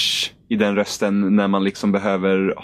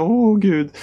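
mock sad; 11 semitones higher than usual. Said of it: "så låter hon väldigt, väldigt ledsen".

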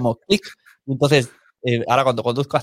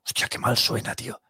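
muttered aside; 7 dB quieter than usual. Retold or imitated. Inner thought hearing bad podcast.

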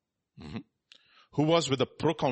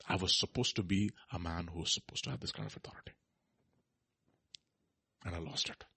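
hushed confession; 4 semitones lower, 6 dB quieter than usual. Acted. Peter's conviction, voiced softly.

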